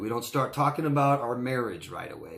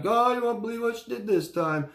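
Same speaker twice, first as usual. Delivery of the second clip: high-pitched mock distressed wail; upset friend; 11 semitones higher than usual. Friend calls the speaker about his girlfriend again, acted upset and high. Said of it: "And he's all upset".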